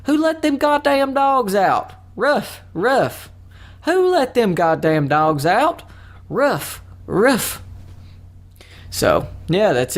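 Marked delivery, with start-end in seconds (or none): 0.1-7.6 high, drawn-out country holler; exaggerated country twang, slow and high-pitched; country style. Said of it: "you can do it country style".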